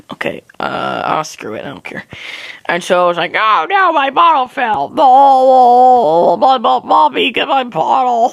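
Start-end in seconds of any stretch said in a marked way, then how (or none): 3.3-8.3 hammed-up toddler wail, high and loud; mock bawling. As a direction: cry out for mommy like a baby. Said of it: "I'm crying my eyes out".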